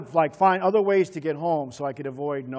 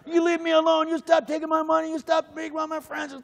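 high, louder indignant shouting; mock angry kid; 13 semitones higher, 3 dB louder than usual. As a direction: tell him off while fighting back. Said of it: "So I jump across that third line".

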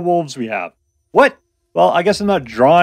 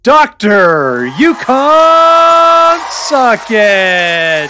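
loud, high, hugely stretched name; hyped-up announcer; 6 semitones higher, 7 dB louder, much slower than usual. Pretend. Host introducing himself to the audience, hyped-up announcer. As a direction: bellow it like a ring announcer, vowels held long.

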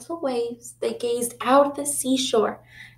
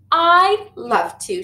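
slow, louder, higher glad reply; delighted yes; 6 semitones higher, 5 dB louder, slower than usual. A narrator's reading. Rita happily agreeing to play, higher and louder. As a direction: accept with joy.

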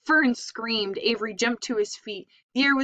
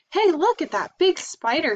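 higher, louder gasp of discovery; excited kid; 8 semitones higher, 5 dB louder than usual. A narrator's reading. Avery spotting Charlotte, high and excited. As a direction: point it out thrilled. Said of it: "he said".

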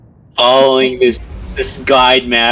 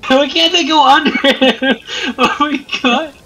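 high-pitched, breaking up with laughter; laughing; 11 semitones higher than usual. Real, not acted. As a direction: squeal it through helpless laughter. Laughing at towering game figure.

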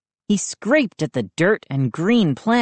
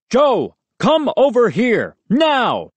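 louder, gruff barked order; barking army dad; 6 semitones higher, 3 dB louder, slower than usual. A narrator's reading. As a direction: bark it like a drill sergeant. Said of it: "Moose's dad barked".

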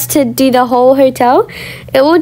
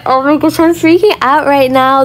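ordinary talk

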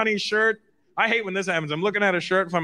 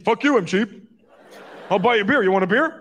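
louder, laughing mock offense; 4 semitones higher, 4 dB louder than usual. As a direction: huff then offer a beer to prove a point.

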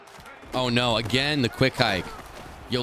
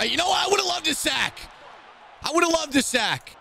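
high-pitched frustrated outburst; indignant high holler; exasperated yell. Real, not acted. Complaining about a missed sack.

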